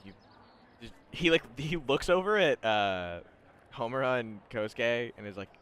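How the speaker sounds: normal talking voice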